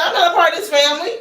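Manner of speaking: high, fast, laughing comeback; laughing, indignant protest; indignant retort. Retold or imitated. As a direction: snap back through a laugh. Retorting to boyfriend's family.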